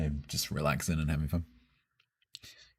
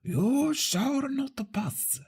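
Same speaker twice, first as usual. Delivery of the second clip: Gandalf; much slower than usual. Pretend. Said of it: "But in a Japanese accent".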